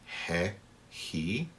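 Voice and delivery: he said like a soft English h sound; soft breathy he. Shown as a demonstration. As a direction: breathe out he, he softly. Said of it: "before e and e".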